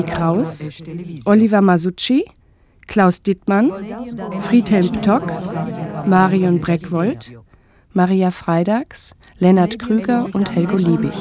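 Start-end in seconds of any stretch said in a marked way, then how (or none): none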